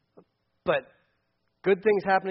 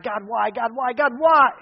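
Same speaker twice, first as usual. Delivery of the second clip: each why rising higher until the last is loud; building cry; 4 semitones higher, 6 dB louder than usual. Retold or imitated. Habakkuk pleading with God, building from plain to a loud cry. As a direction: plead harder on every repeat. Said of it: "He's crying out to God".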